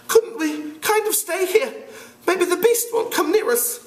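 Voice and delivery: put-on a fat anxious boy begging to stay safe; timid Piggy. Piggy pleading with the boys to stay put, nervous.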